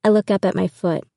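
ordinary delivery, nothing marked

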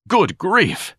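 high breathless exclamation; gasped. Acted. Ignatius gasping as he gropes for the door. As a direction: gasp it out in alarm. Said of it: "Ignatius gasped".

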